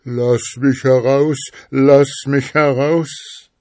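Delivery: high, loud trapped-spirit voice, drawn out; muffled cry for help. A narrator's reading. Trapped spirit begs the schoolboy to free it, muffled high cry. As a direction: call out loudly from a sealed bottle. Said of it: "mit einem recht dumpfen Ton rief".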